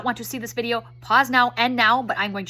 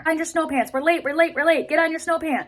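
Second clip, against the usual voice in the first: put-on higher-pitched, insistent mom hurrying a child; hurried nagging; 4 semitones higher than usual. Mom to daughter running late for school, hurried nagging. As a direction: nag the kid along, urgent. Mom rushing kid before bus.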